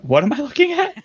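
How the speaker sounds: high, stunned question; bewildered at the sight; surprised. Real, not acted. Players see the monster map.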